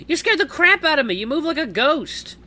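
high, loud cry after a fright; startled exclamation. Acted. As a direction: exclaim loudly, still shaken by the scare. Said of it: "trying to calm my startled heart".

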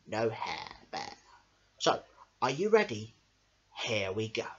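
ordinary delivery

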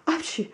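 one big storybook sneeze. Acted. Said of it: "He gave one big".

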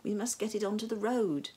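ordinary delivery

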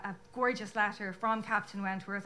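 normal talking voice

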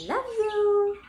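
high parrot imitation. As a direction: chirp it like a bird. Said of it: "he would say other things like".

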